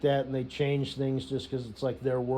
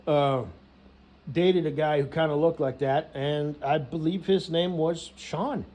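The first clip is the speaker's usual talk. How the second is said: ordinary delivery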